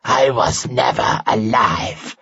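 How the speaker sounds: slow, rasping monster hiss; hissing creature. A narrator's reading. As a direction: hiss it like a dying man wheezing. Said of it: "hissed the creature".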